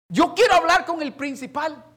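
loud, high demand; angry insistence. Retold or imitated. Father insisting to the assistant on the principal, angry insistence. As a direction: re-enact the demand at full voice.

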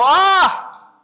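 sudden high cry of distress. A narrator's reading. Preacher voicing the desperate woodcutter, crying out. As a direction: cry out to the Lord in dismay. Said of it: "gritó diciendo".

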